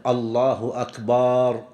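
"أكبر" said as "akbaar", the last vowel drawn out long; lengthened baa. Shown as a demonstration. Teacher showing a wrong takbir, "أكبر" drawn out to "akbaar". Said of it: "ألا يمد الباء".

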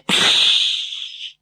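long hiss through clenched teeth; drawn-out hissing. A narrator's reading. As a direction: hiss like shooing a cat. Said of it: "С шипением процедив этот последний звук сквозь зубы".

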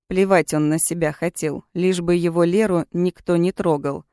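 ordinary delivery

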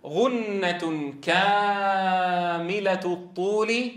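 very slow, stretched "غنة كاملة الطول"; long nasal. Shown as a demonstration. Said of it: "يصاحب ذلك".

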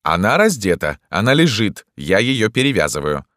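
an emphatic doctor warding off a visitor; irritated doctor. A narrator's reading. Doctor figure objecting.

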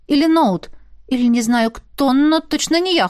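ordinary delivery

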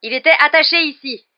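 loud, high furious protest. A narrator's reading. Executioner finding the animal gone, furious. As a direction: shout it in a fury. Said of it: "dit le bourreau avec fureur".

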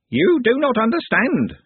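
high, slow screechy creature; bird creature. A narrator's reading. Bird creature insisting to Glenda, high screech.

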